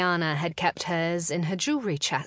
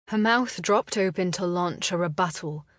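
ordinary delivery

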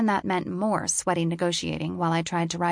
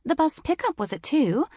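prim British woman; 9 semitones higher than usual. A narrator's reading. Voices the British office woman.

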